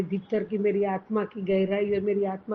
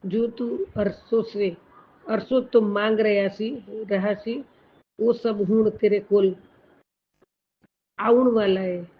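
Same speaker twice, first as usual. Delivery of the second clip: louder, slow-starting message read in Punjabi; proclaiming blessing; 5 dB louder, slower than usual. Acted. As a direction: build the promise up to a loud close. Reads Guru's message.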